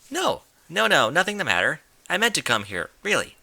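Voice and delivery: flustered denial. Acted. Rainbow denies, flustered.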